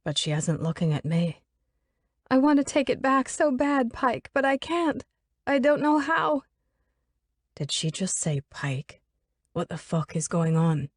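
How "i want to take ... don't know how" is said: high pleading female voice; distraught young woman. Acted. Elizabeth pleads with the absent Pike, high and distraught. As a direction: beg an unseen friend for help.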